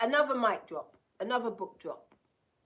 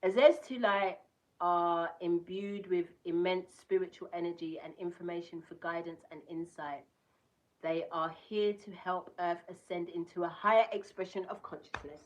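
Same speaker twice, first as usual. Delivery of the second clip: card reading voice; 2 semitones higher, 8 dB louder than usual. Acted. Reading the Azez Tulite card to viewers, declaiming. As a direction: read out the crystal card, louder, declaiming.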